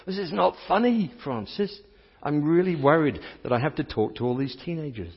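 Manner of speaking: a hint of a panicked speaker; complaining worried self. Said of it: "I kept complaining".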